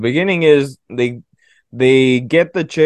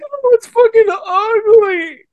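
mock girly squeal; 5 dB louder, slower than usual. Mocking a girl seeing a ghost.

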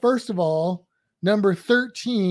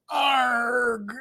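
pirate growl; 5 semitones higher than usual. Pretend. Pirate name announced.

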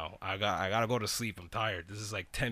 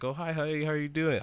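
higher, sugary imitation of the girl; mock sweet greeting; 6 semitones higher than usual. Imitating the girl greeting him as if nothing happened, mock sweet. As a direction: coo it like a fake innocent girlfriend.

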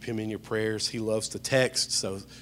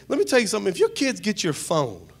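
ordinary delivery

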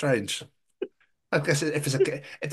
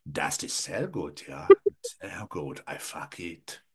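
much softer, low German growl; mock German dog; 9 semitones lower, 6 dB quieter, slower than usual. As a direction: mutter it like a lustful German dog. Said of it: "German Shepherd".

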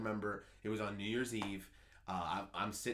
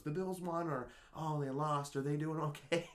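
imitating grandmother; 7 semitones higher than usual. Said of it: "my Nana always calls me".

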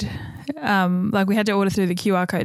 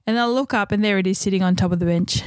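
normal talking voice

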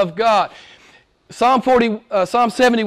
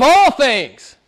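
raised, ringing preacher's climax; emphatic climax; 5 semitones higher, 4 dB louder than usual. Real, not acted. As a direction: drive it home, pitched high. Preacher climaxes, then echoes it softly.

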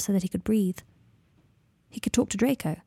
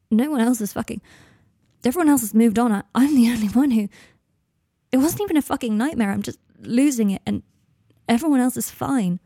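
upset outburst; 3 semitones higher, 4 dB louder than usual. Acted. Harry's upset outburst to Draco.

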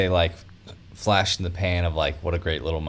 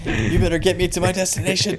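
much higher, fast, laughing; movie trailer voice; 11 semitones higher, faster than usual. Retold or imitated. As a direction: rush it like a spoof trailer. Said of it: "Kramer's movie phone".

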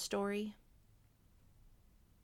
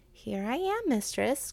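cried; 5 semitones higher than usual. A narrator's reading. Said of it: "cried Whitehead".

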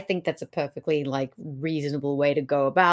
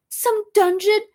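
high, louder mock-incredulous question; scornful question; 5 dB louder than usual. Lady Anne answering Richard's riddle, scornful question. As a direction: throw the guess back, pitched right up. Anne's scornful guess, acted.

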